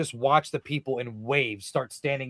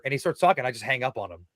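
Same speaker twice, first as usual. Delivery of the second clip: usual speaking voice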